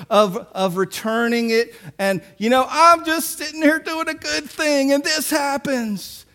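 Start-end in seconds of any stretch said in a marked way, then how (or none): 2.7-6.3 high-pitched indignant complaint, imitated; acted-out self-pitying protest, pitched up; mock pity party. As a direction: play up the aggrieved whining. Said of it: "He has a huge anger and pity party".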